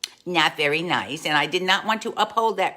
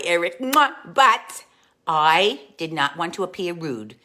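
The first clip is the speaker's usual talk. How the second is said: ordinary talk, nothing marked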